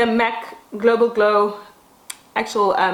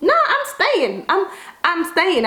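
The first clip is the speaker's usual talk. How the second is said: panicked quote; 8 semitones higher than usual. Retold or imitated. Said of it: "I'm like".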